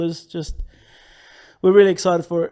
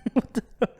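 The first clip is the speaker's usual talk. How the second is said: fast echo over a radio, with a laugh; 5 semitones higher, faster than usual. Pretend. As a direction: bounce it back like a radio echo. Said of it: "But in a radio voice".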